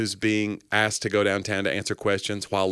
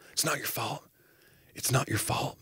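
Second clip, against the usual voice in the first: soft, higher, insistent reassurance; Good Will Hunting; 4 semitones higher, 7 dB quieter than usual. Acted. Therapist figure comforting a patient, hushed. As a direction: repeat it hushed and gently pressing. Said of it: "like in Good Will Hunting".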